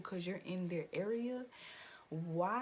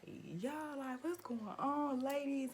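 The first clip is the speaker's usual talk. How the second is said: higher, lightly voiced intervention; imitating concert worker; 7 semitones higher than usual. Concert worker asks the ladies what's happening, mimicked concern. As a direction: pitch it up like staff checking in.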